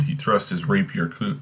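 ordinary talk

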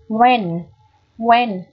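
"when" with a clear short E; E sound. Shown as a demonstration. Teacher shows learners the E vowel, clearly modelled. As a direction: model "when" slowly, E as in "bed". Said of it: "vẫn được phát âm là âm E".

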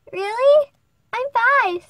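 high, loud surprise; surprised little girl. Acted. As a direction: squeal it in surprise.

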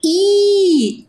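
teacher sounding out "I"; vowel sound demo. Shown as a demonstration. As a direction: sound out "I" for the class. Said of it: "E agora?".

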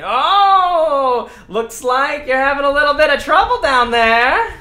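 loud, high taunting character voice; unseen taunter. Acted. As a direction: call out mockingly to people trapped in the dark. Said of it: "someone in the background saying".

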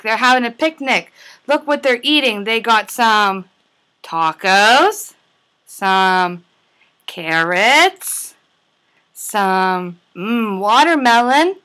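ordinary delivery, nothing marked

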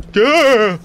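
sharp startled cry of fright; startled yelp. Real, not acted. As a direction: yelp at the jump scare. Jump scare in horror game.

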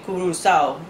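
Curacao said as "koo-doo-sow"; pretty island pronunciation. Shown as a demonstration. Vlogger shows how islanders say it, Curacao as "koo-doo-sow". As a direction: say Curacao with oo vowels and a tapped r. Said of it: "they said it all pretty".

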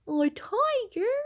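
high, loud, slow delighted shout; loud high joyful exclamation; excited shout. A narrator's reading. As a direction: cry out with delight at the picture. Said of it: "the tiger shouts".

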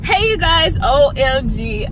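bright, loud, high hello to viewers; excited greeting. Real, not acted. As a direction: burst in thrilled to see everyone. Excited greeting opening new clip.

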